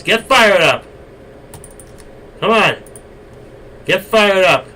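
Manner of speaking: loud, high pep shout; hype call. Real, not acted. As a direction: fire up the audience. Hyping up the chat.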